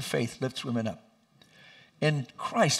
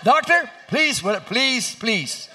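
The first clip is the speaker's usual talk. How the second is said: high, loud frantic begging; panicked pleading; 7 semitones higher, 5 dB louder than usual. Retold or imitated. Husband begging the doctor, high panicked plea. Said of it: "She got even more ferocious".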